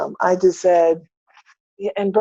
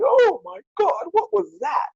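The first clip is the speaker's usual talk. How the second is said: mock stunned high voice; 13 semitones higher than usual. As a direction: gasp it out in disbelief. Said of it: "And I was like".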